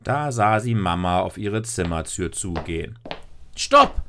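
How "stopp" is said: loud, very high shout of alarm; called out. A narrator's reading. Lisa stops Mama heading for her door, calling out. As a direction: shout it sharply to halt someone. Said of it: "rief Lisa".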